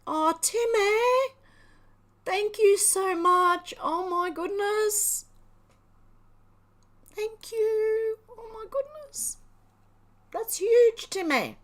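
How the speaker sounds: high, loud, slow gushing gratitude; overwhelmed thanks. Real, not acted. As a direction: draw out every word, overcome with thanks. Thanking donor Timmy on stream.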